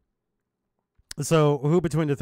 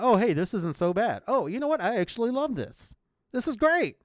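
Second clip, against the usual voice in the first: mock delight building; 7 semitones higher than usual. Friend acting out someone warming to the food, mounting mock delight. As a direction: voice climbs higher into delight. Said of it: "on consuming a little bit more, found out".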